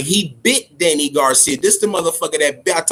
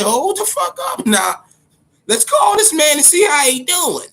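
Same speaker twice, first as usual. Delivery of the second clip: high, shouting mock alarm, officials scrambling; panicked officials; 11 semitones higher, 3 dB louder than usual. Officials deciding to phone the fighter, hammed-up high shout. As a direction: yell it like a boardroom in sudden panic.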